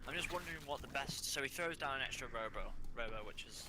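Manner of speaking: too quiet. Real, not acted. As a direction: speak like the mic is turned down. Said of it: "I just heard that your voice is not so loud".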